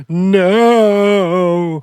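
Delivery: loud, high, drawn-out mock wail; imitated crying. Crying Yuvi refuses coach's company, mock wail. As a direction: bawl it like an upset little kid. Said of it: "just crying".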